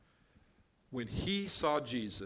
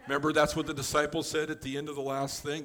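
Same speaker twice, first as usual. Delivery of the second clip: ordinary talk, nothing marked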